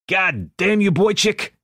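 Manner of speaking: rowdy friendly curse; loud gruff outburst. A narrator's reading. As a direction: bellow it like a joyful old gangster. Ed's gruff joyful outburst.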